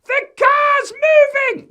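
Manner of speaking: put-on very high, stretched-out child's scream; shrieking little boy raising the alarm. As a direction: scream it slowly at full pitch. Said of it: "he's screaming".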